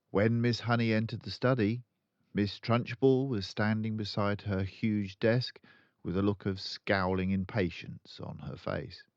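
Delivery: usual speaking voice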